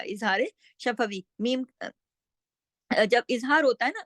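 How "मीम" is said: मीम held long with clear lips; clear meem. Shown as a demonstration. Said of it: "मीम की आवाज पूरी".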